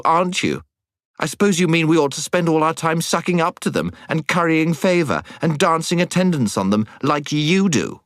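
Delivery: indignant high-pitched tirade; angry temper. A narrator's reading. Jill telling off the boy who came barging in, angry. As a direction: keep the scolding pitched high, piling up accusations. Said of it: "Jill suddenly flew into a temper".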